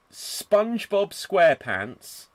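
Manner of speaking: ordinary delivery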